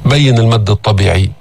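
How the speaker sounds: usual speaking voice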